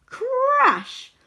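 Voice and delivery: high, loud, drawn-out word; storybook sound effect, suddenly loud; loud crash effect. Acted. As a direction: make the word itself crash. Sound effect of tree falling.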